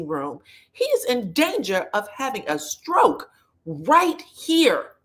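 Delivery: high, urgent warning; urgent doctor. Retold or imitated. Doctor warning Trina about her husband, high urgent alarm. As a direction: warn her like a frantic doctor. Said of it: "the doctor says".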